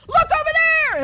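loud high shout; distracting yell. People yelling at you, loud high shout. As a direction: yell to pull attention away.

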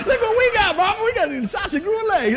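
calling to Mama, high and laughing; mock excitement. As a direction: call out amazed about the dog. Imagined family surprised at home.

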